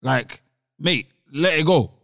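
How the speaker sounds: ordinary talk, nothing marked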